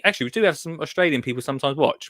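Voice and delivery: ordinary talk, nothing marked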